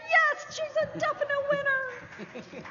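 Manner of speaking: very high, slow squeal; excited squeal. Real, not acted. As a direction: squeal with delight. Winner confirmed.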